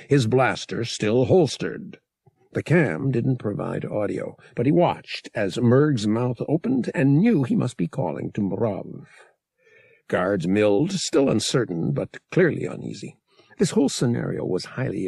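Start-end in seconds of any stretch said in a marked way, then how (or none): none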